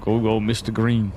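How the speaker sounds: ordinary talk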